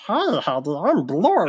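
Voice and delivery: lightly voiced silly alien; a hint of a goofy alien; crazy alien voice. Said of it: "this crazy".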